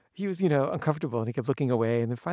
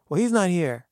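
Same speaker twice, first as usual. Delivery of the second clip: higher, louder dismissal; curt brush-off; 6 semitones higher, 9 dB louder than usual. Retold or imitated. Gardener turning away the fans, loud and curt. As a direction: shut them down.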